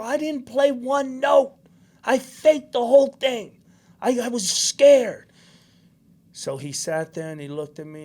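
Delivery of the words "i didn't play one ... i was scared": high, loud, slow imitated wail; tearful confession. Son admitting to dad he faked it, mock tearful outburst. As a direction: plead like an upset kid, acted out. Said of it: "I'm crying".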